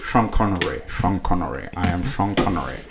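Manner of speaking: low, quiet Sean Connery impression. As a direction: warm up a deep Scottish Connery voice. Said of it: "I'm just getting into the voice".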